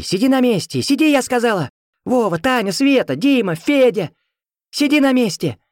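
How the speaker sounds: high mothers' shouted orders; frantic yelling. A narrator's reading. Mothers ordering children to stay seated, frantic high yelling. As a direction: yell like mothers holding children back. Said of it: "неистово орущие".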